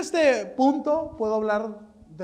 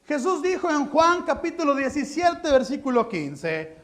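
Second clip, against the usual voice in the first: ordinary talk, nothing marked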